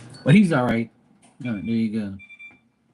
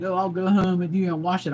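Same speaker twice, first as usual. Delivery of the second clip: mom voice; 7 semitones higher than usual. Retold or imitated. Son quoting his mom.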